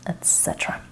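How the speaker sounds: whisper. Real, not acted. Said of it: "trailing aside".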